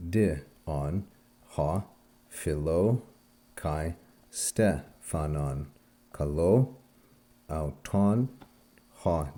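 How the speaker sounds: slow, deliberate Greek, pausing after each small group, as in "philo ... kai, stephanon"; stress-accented Greek demo. Shown as a demonstration. Teacher showing students how to pronounce "philo" and "kalo" by stress, stress-accented Greek demo. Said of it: "my own pronunciation goes based on stress".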